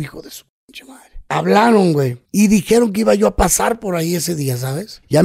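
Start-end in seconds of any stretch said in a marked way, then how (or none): 0.0-1.1 menacing whisper, barely voiced; whispered threat. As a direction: hiss the insult like a gunman close to your ear. Retold armed men threatening him. (amenaza susurrada)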